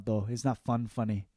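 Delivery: ordinary talk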